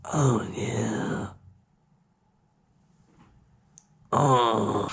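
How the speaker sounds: low, soft, drawn-out moan; groggy groaning. Retold or imitated. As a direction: groan as you haul yourself up. Acting out groggily getting up.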